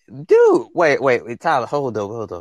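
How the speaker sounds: jumps way up on dude, then hurried wait wait; startled outburst, then reining it in; sudden surprise. Real, not acted. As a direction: caught off guard by a comment mid-thought. Spots surprise guest in comments.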